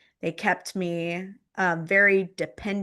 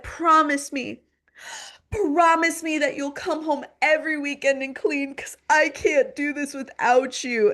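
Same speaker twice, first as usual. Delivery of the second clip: high-pitched, insistent mock begging; imitated tearful pleading; 8 semitones higher, 4 dB louder than usual. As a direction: beg like a clinging, sobbing mother. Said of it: "like, sobbing, like, hanging all over me".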